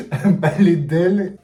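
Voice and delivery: loud, laughing, slow repeat of the title. Real, not acted. Vlogger laughing at her own clumsy translation, loud and amused. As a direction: burst out laughing at the silly title.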